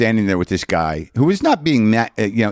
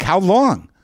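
much higher, fed-up question; exasperated; 9 semitones higher than usual. Retold or imitated. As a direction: demand it impatiently. Quarrel with rental clerk.

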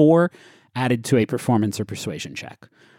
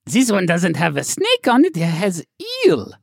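high put-on seller's voice; raised-voice comic sales pitch; eager shopkeeper; 8 semitones higher than usual. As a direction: pitch the gadget in a high character voice.

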